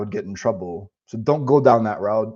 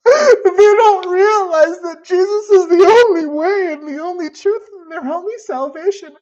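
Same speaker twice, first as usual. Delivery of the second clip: mock crying girl; 6 dB louder than usual. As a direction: wail it like the distraught girl on video. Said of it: "she's crying on the video".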